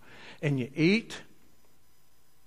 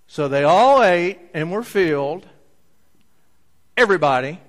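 loud proclaim; 3 semitones higher, 9 dB louder than usual. Acted. Preacher exclaims reading.

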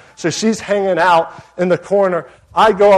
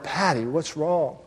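gentle concern; 7 dB quieter, slower than usual. Retold or imitated. Pastor kneels by crying woman.

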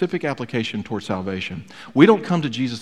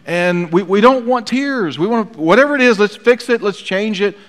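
impatient fix-it urgency; 9 semitones higher, 6 dB louder than usual. Acted. Preacher mocking fix-it culture.

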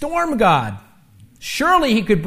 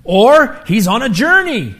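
taunting; 5 semitones higher, 7 dB louder than usual. Acted. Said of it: "He's taunting them".

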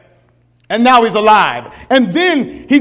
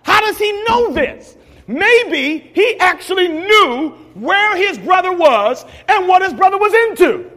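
higher, shouted sermon climax; preaching at full force; 7 semitones higher than usual. Acted.

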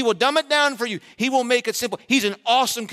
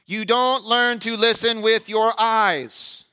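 usual speaking voice

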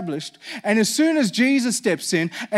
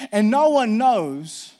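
slow emphatic; 2 semitones higher, 4 dB louder, much slower than usual. Acted. Preacher slows for emphasis.

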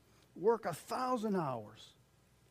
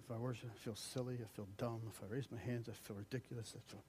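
softer, lower, grumbling; half-muttered mock excuse of a reluctant worshipper; whiny doubter; 5 semitones lower, 9 dB quieter, faster than usual. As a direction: mumble the self-conscious excuses. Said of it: "But you reason".

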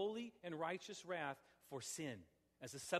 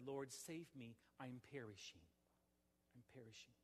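"Lord, save me, I'm perishing" murmured much softer, fading away; hushed plea; 4 semitones lower, 10 dB quieter than usual. Shown as a demonstration. Pastor voicing a sinner's private plea to the Lord, hushed and fading. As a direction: sink the repeated "I'm perishing" down to almost nothing. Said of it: "with an exclamation mark and not a question mark".

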